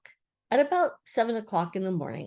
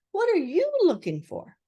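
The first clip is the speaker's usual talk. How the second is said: high, lively question; inquisitive Rabbit; 11 semitones higher, 4 dB louder than usual. A narrator's reading. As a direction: ask it in a raised, busy voice.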